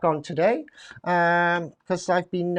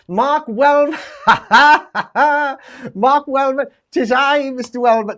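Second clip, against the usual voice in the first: loud, high, gleeful hello; delighted greeting; 6 semitones higher, 9 dB louder than usual. Real, not acted.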